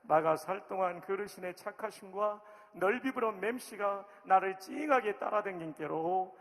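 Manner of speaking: sing-song Jeolla accent with a long drawn 아따; Jeolla dialect. Shown as a demonstration. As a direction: Jeolla accent, exuberant and loud. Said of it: "이제 전라도 버전입니다".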